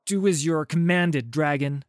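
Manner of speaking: loud, high, forceful order; shouted command. A narrator's reading. Warrior Pantheon demanding the dragon obey, shouted command. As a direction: shout it down at a defiant subordinate. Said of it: "this Pantheon shouts".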